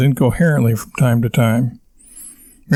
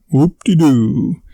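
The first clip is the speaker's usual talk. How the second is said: slow, drawn-out mock enthusiasm; slow sarcastic dismissal; 2 semitones higher, slower than usual. A narrator's reading. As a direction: stretch it out, thoroughly unimpressed. Wife's sarcastic retort to husband's tirade.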